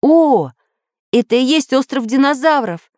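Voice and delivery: high exclamation of joy; delighted. A narrator's reading. Samodelkin spots dinosaur island, high delighted cry. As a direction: burst out gladly at the sighting. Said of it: "обрадовался Самоделкин".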